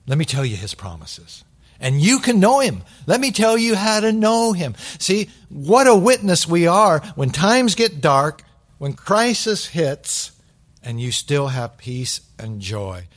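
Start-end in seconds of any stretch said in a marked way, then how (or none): 1.8-10.3 loud, high, fervent preaching, slowing at the end; preaching at full force. Real, not acted. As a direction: preach it out with full conviction, drawing the last words out. Builds to a passionate climax.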